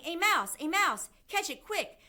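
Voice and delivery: urgent high shouts, one after another; shouting. A narrator's reading. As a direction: rouse the whole kitchen to the chase. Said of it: "they were shouting".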